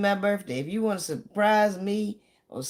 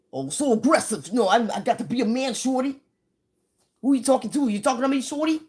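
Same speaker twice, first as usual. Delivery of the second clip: higher, louder tough-guy bluster; mock tough guy; 6 semitones higher, 3 dB louder, faster than usual. The storyteller mocks a brash newcomer from up north in a tough-guy voice. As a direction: puff up like a street tough, daring someone. Imitating aggressive northern guys.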